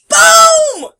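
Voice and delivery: huge high shout of boom. Acted. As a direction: yell it like an explosion. Shouted sound effect.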